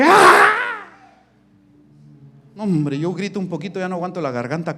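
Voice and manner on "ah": mock preacher shout. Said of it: "Hay predicadores así".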